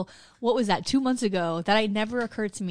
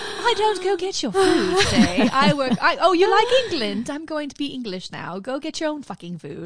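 put-on English accent; 5 semitones higher, 5 dB louder than usual. Suggesting the wife mock husband in English accent. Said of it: "put on an English accent and go".

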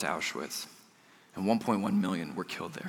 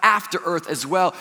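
eager longing; 7 semitones higher, 4 dB louder than usual. Retold or imitated. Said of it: "We think".

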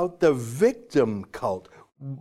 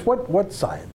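ordinary delivery

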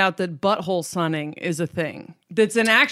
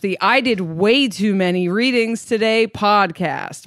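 louder, higher upbeat show opener; podcast host intro; 4 semitones higher, 4 dB louder than usual. Acted. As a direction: kick off the show with energy.

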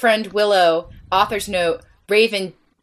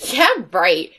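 usual speaking voice

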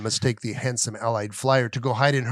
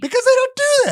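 squeaky high voice; 4 dB louder than usual. Real, not acted. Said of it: "Do you notice how your voice ... squeaked".

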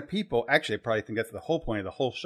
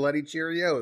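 mock British catchphrase, raised pitch; thick British accent; 5 semitones higher than usual. Mocking the actor's lost Russian, thick British accent. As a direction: put on an exaggerated British accent. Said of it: "very thick British accent".

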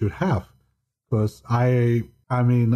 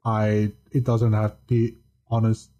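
ordinary talk, nothing marked

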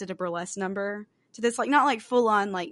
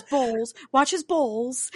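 Australian "bowls"; 5 semitones higher, much slower than usual. Retold or imitated. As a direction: mimic Australian "bowls" with an o vowel, slow. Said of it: "she drops her accent".

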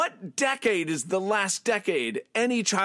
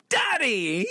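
high DADDY, hit hard then rising; an emphatic, high-pitched DADDY with a questioning tail; emphasis ending in question; 8 semitones higher than usual. Shown as a demonstration. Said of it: "it's an emphasis and then ending in a question".

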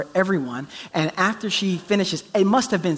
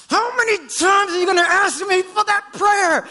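much higher, louder impatient shout; annoyed God; 12 semitones higher, 4 dB louder than usual. Retold or imitated. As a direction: imitate an exasperated God snapping at a nagging asker. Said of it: "That God has".

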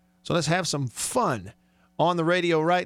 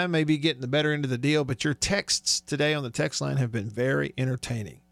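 usual speaking voice

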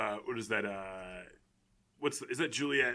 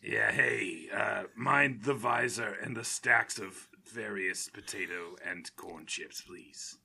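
low, unhurried character drawl, trailing softer; Swordfish; 8 semitones lower, 6 dB quieter, slower than usual. Acted. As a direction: play a slow, low-voiced oddball customer. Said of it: "Hey, Swordfish".

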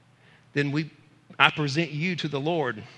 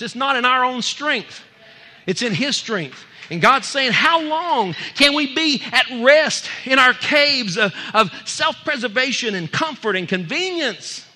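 loud, high-pitched sermon delivery; fired-up preacher ringing out; full-force preaching; 8 semitones higher, 7 dB louder than usual. Acted. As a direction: preach it hard, voice raised.

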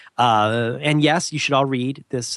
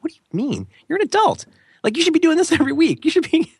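much higher, louder incredulous outburst; incredulous exclamation; 4 dB louder than usual. Retold or imitated. Interviewer tells friend she should interview weekly, loud disbelief.

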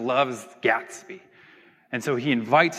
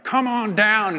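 higher, louder announcer call; game show announcer; 7 semitones higher, 5 dB louder, slower than usual. Acted. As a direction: call up a contestant, hammed-up.

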